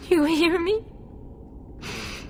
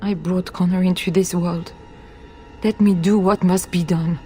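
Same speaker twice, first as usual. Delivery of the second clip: low, grave, resigned plea; grim resignation; 7 semitones lower, faster than usual. Acted. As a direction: speak low, resolved to do the unbearable. Mother resolves to end it herself.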